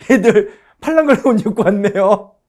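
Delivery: chuckling through the line at the underdressed troops; laughing mockery. Teacher laughing at invaders in thin summer clothes, amused chuckle. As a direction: laugh it out, amused at their folly.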